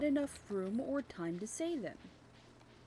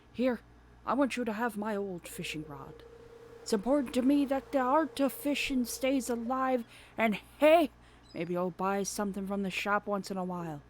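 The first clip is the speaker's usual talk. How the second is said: louder hearty sailor voice; earnest old salt handing down a rod; old fisherman; 5 dB louder than usual. Pretend. Voicing Willy.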